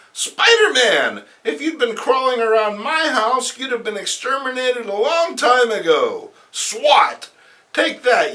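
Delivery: high, loud taunting villainess; high loud taunt during the fight; villainess attacking. Acted. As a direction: sneer at the bug as you swing. Felicity attacks Spider-Man.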